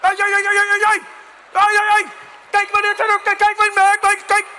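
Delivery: high, very loud mock-Dutch yelling; hammed-up outrage. Cyclist coming at you full speed, hammed-up yelling. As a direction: lose it in a torrent of yelped gibberish. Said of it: "people lose their shit".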